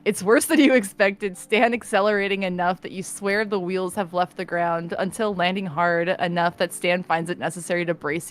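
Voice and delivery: calm narration read; story narration. A narrator's reading. Narrates the scene.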